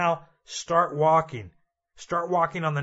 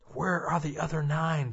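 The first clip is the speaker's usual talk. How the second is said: Jesus asking; 2 semitones lower, 6 dB quieter than usual. Acted. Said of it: "Jesus said".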